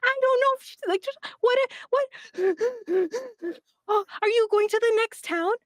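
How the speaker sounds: put-on high kid voice whistling through the teeth; older sister with a gap in her teeth; breathy high child voice; whistling gap-toothed kid. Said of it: "whistle ... as they talk".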